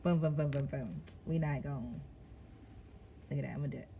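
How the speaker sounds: normal talking voice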